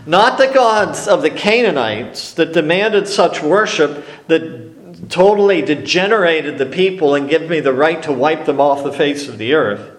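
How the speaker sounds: ordinary delivery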